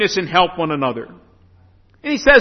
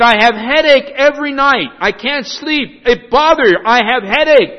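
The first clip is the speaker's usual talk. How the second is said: plaintive broken English; 5 semitones higher, 4 dB louder than usual. Retold or imitated. Husband complains of sleepless nights.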